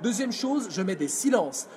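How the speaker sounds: ordinary delivery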